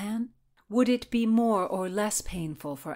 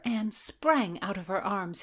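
ordinary delivery, nothing marked

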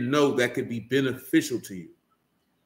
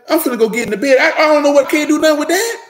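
much louder and higher, laughing exaggeration; loud mock whining; 11 semitones higher, 10 dB louder than usual. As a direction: blurt it out like a fed-up overgrown kid. Mocking the man going to bed.

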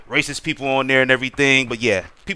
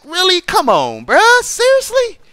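much higher, loud incredulous "really? come on, bruh"; exasperated disbelief; 5 dB louder than usual. Real, not acted. Streamer reacting to a cheater, in high exasperated disbelief. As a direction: squeal out your disbelief at the cheat.

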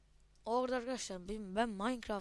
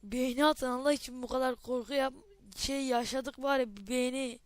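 loud pleading; 2 semitones higher, 8 dB louder than usual. Real, not acted. Begging viewers for likes.

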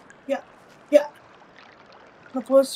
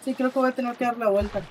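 ordinary talk, nothing marked

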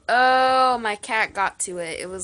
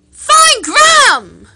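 Find Graham shouted much higher and louder, slowly; shouted name; 13 semitones higher, 15 dB louder, much slower than usual. Shown as a demonstration. Coaching a friend to say Graham, shouting Find Graham. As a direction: bellow Find Graham with Graham drawn out. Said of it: "Say it".

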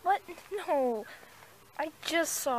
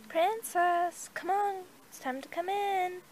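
calling out; 6 semitones higher, slower than usual. Acted. Calling horse in from field.

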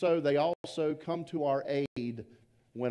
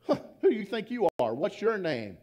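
higher, louder, fast irritated challenge; irritated thief; 6 semitones higher, 4 dB louder than usual. Acted. Thief scolding the parrot, mock irritated and higher. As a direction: snap at the bird as the annoyed burglar. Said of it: "he's kinda irritated".

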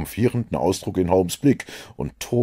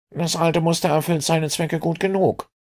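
much higher, curt inspector voice; a prickly policeman brushing him off; higher, dismissive Scotland Yard inspector; Lestrade; 11 semitones higher than usual. A narrator's reading.

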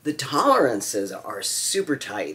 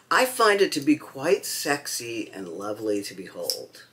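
ordinary talk, nothing marked

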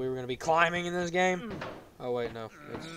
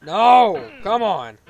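loud, raised-pitch exasperation; frustrated shout; 3 semitones higher, 14 dB louder, much slower than usual. Real, not acted. Player fails in game.

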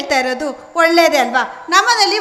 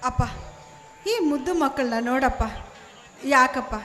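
much softer, gentle coaxing; soft consoling child; 11 dB quieter than usual. Acted. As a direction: hush him gently. (ಅಪ್ಪನಿಗೆ ಮಗುವಿನ ಮೃದು ಸಾಂತ್ವನ)

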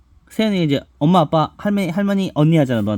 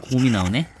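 usual speaking voice

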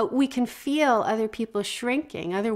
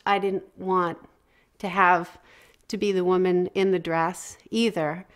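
ordinary talk

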